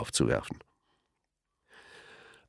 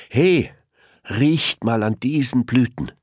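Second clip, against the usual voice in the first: high, louder friendly call; Elmar calling out; 7 semitones higher, 3 dB louder than usual. A narrator's reading. Elmar calls to the passing elephants, eager call. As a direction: call the others over eagerly. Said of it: "rief Elmar".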